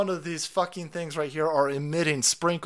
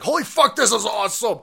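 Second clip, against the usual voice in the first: excited cheer; 7 semitones higher, 7 dB louder than usual. Retold or imitated. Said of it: "every other generation was like".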